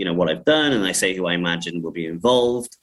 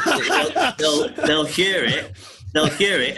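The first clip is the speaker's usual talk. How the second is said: real laugh breaking into high words; laughing while talking; 7 semitones higher, slower than usual. Real, not acted. Director explaining his prompts to actors, laughing. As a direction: crack up and push on through it.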